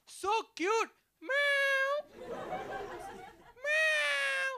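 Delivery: high cutesy meowing; cooing at a kitten. Retold or imitated. Sister to kitten, cooing meows, high cutesy voice. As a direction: coo sweetly at a kitten. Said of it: "she goes".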